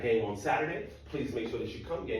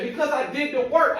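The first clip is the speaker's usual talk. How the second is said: put-on higher, louder indignant girlfriend; girlfriend's voice; 11 dB louder than usual. Girlfriend demanding her four point oh, higher indignant voice. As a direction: insist like she's been robbed.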